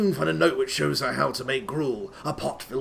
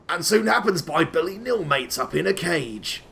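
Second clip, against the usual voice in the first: usual speaking voice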